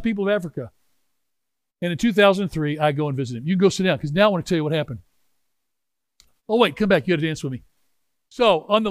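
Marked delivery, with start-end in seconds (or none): none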